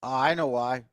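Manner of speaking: ordinary talk, nothing marked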